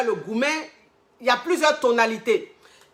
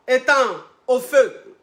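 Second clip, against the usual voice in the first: ordinary talk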